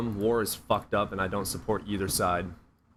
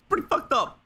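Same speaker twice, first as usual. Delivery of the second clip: squeaky mock voice. Squeaky mocking voice.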